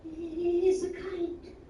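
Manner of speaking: hammed-up classmates gasping in awe; mock amazement. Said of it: "people are like".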